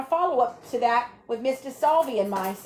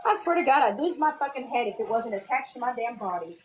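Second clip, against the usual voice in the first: ordinary talk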